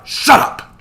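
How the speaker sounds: loud, forceful whispered hiss; sharp hiss. A narrator's reading. Joshua silences Samuel near the nymphs, sharp hiss. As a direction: hiss it hard as a rebuke. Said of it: "Joshua hissed".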